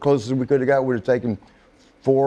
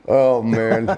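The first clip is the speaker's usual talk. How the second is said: 1 laughing dismay; 2 semitones lower than usual. Retold or imitated. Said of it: "I was like".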